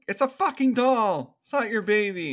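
loud, high-pitched protest; acted exasperated outburst. Clara to the woman mothering a doll, acted loud exasperation. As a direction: protest loudly, imitating someone fed up.

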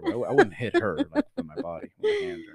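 laughing through the words. Real, not acted. Friend laughing while denying he'd hit her.

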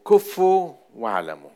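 preacher booming the verse's command; emphatic loud proclamation. Shown as a demonstration. Preacher to congregation, loud emphatic command.

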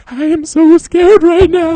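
high, slow quavering fear; hammed-up Blair Witch sobbing fear; mock terrified. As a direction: imitate her trembling on camera. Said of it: "And she".

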